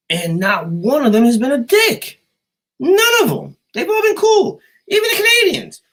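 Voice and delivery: loud squeal of insistence; emphatic high-pitched insistence. Real, not acted. Insisting people abroad have all been cool, high squeal. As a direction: climb into a high, loud falsetto protest.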